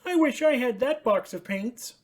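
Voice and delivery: high, bright puppet voice for Ernie; excited high Ernie calling out. Acted. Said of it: "said Ernie".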